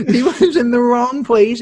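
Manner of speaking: words spilling through a real laugh; amused laugh while telling the story. Real, not acted. Laughing at his own story.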